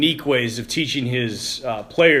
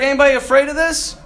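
coach asking; 12 semitones higher, 5 dB louder, faster than usual. Retold or imitated. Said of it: "He's like".

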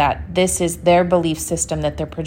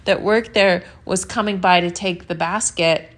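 usual speaking voice

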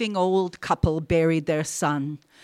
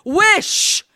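high, loud, insistent command; strong cry; 13 semitones higher, 8 dB louder than usual. A narrator's reading. Wife insists to her husband, high and forceful. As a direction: cry it higher, with full force. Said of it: "cried in a strong voice".

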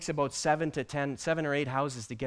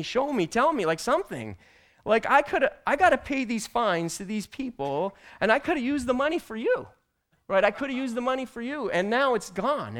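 higher, louder frustrated complaint to God; mad at the Holy Spirit; 8 semitones higher, 5 dB louder than usual. Retold or imitated. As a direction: vent your frustration loudly, pitched up. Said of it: "I said".

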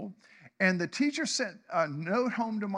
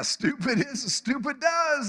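lightly voiced simple southern man, higher and a bit louder; Forrest Gump; 6 semitones higher, 4 dB louder than usual. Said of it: "Forrest Gump's one of my favorite movies".

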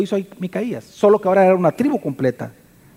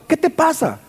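scornful, pitched higher; contemptuous; 9 semitones higher than usual. Acted. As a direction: sneer at the man you robbed. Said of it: "le hablan despectivamente a Micaías".

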